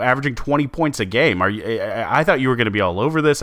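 ordinary delivery

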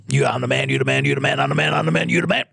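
low repetitive radio chant, lightly voiced; imitating the radio host chanting you the man; imitating Scott Farrell. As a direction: riff like a talk radio host hyping the caller. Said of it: "He talk like this".

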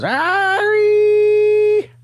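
very high, loud, sustained cry; super-voice blast. Retold or imitated. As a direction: blast it out like a sonic scream. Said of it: "he goes".

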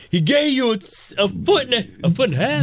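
high-pitched imitation of a film line; movie-quote impression. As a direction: quote the scene high and emphatic. Quoting a comedy film scene.

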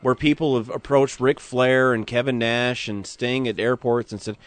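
ordinary talk

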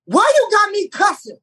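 high, put-on scolding voice of an offended older woman; imitating Miss Pat. Quoting Miss Pat objecting to the cursing in his script, high mock outrage. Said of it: "She said".

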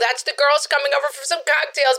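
lightly voiced chatty neighborhood mom; breezy mom who is proud of the nephew; gossipy suburban mom. Sketch mom wrapping up call.

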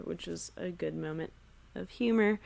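ordinary talk

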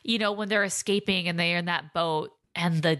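usual speaking voice

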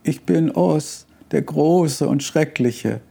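put-on high, slow, mildly fearful old man; trembling voice. A narrator's reading. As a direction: quaver as if cornered. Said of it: "der Mann mit zitternder Stimme". (mit zitternder, hoher Stimme)

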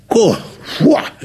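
un ¡fua! que sube muy alto de asombro; exclamación asombrada. Real, not acted. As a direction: reacciona conmovido y sorprendido por la letra. Reacción a la letra del bolero. (asombro agudo y sonoro ante la canción)

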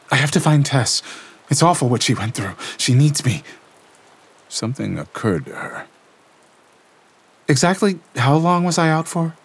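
put-on high, tense young woman's voice reliving horror; Lena shaken. A narrator's reading. Lena telling Gabriel what she felt, shaken and tense. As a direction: voice Lena trembling through the vision. Lena insists on finding Tess.

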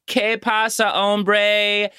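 high, hammed-up bro Spanish greeting; mocking a tourist bro's Spanish. Host mocking Charlie's Spanish greeting on FaceTime, high bro voice. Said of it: "he's like".

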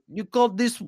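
high, badly faked Belgian accent; indignant attempt at Belgian; fumbled Belgian accent, drifting Russian. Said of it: "how Belgian talks".

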